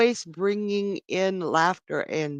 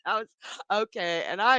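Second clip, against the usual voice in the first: normal talking voice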